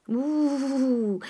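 high grunt through a full mouth. A narrator's reading. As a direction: grunt while chewing. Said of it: "la bouche pleine". (grognement la bouche pleine)

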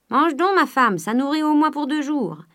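talking through a mouthful; mouth full. A narrator's reading. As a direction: say it while chewing food. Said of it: "la bouche pleine". (la bouche pleine)

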